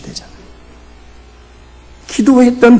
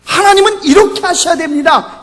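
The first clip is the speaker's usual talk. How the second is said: Jonah insisting; 10 semitones higher than usual. Retold or imitated. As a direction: voice Jonah demanding that God do it his way. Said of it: "이게 요나예요".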